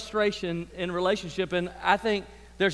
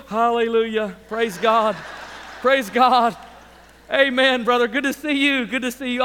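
a touch of hammed-up, louder church cheer; fake cheerful churchgoer; 2 semitones higher, 5 dB louder than usual. Late family greeting the church folk, mock cheerful. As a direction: put on a bright Sunday smile voice. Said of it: "and you walk in the door, and you go".

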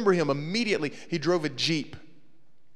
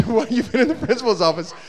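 laughing question; 7 semitones higher, 7 dB louder than usual. Retold or imitated. Teller asking Daniel, laughing.

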